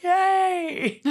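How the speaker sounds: high, loud little cheer; happy cheer. Retold or imitated. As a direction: squeal yay with glee. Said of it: "And I was like".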